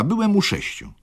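put-on high, airy young clerk's voice; a dandyish shop clerk boasting; lightly voiced Mraczewski.

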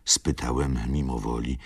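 plain reading voice

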